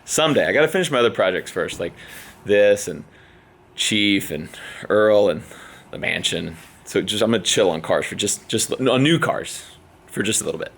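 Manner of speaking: ordinary talk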